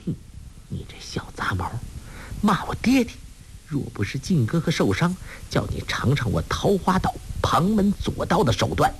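put-on young woman cursing silently to herself; inner scolding. A narrator's reading. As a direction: scold him under your breath, pitch climbing. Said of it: "心中骂道".

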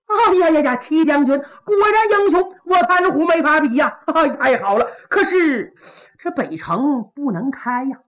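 butter up the general, then refuse; fawning Pan Hu. Acted. Pan Hu's sly flattering voice. (潘虎假意奉承，高声油滑)

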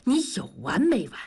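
a sharp, impatient line; stern snap. A narrator's reading. Fed-up friend snaps at gossiping Dazhuang, stern. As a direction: cut him off sternly. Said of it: "严肃地说".

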